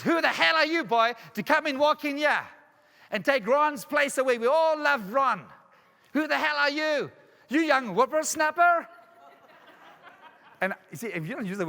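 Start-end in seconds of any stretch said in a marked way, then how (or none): 0.0-8.9 high, loud, drawn-out indignant outburst; acted outrage, slow and raised in pitch; angry old man. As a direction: imitate an old man's furious challenge. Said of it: "an old man slams his fist down".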